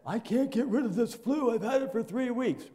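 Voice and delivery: high, whiny mock complaining; griping complaint. Churchgoer griping to the pastor about flu, high mock whine. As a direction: imitate a grumbling flu patient. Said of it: "griped and moaned and complained".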